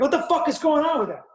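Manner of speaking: startled thug yelling at a noise; fast panicked shout from the woods; alarmed shout. Retold or imitated. Bad guys startled in woods.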